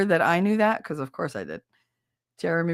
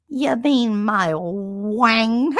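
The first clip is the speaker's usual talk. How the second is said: slow, drawn-out puzzled Cage voice; Nicolas Cage impression; 3 semitones higher, 4 dB louder, much slower than usual. Quoting Nicolas Cage in Peggy Sue, slow baffled voice. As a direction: stretch it out, baffled. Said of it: "And he goes".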